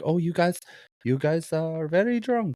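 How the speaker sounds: high-pitched mock Italian old woman; terrible Italian accent. Old Italian woman telling tourists they are drunk, bad Italian accent. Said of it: "Terrible Italian accent".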